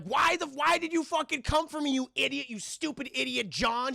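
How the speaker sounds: high, scolding tirade; mock berating. Sarah berating John for coming to rescue her, angry scolding. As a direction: imitate a mother tearing into her son. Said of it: "she's berating him horrifically".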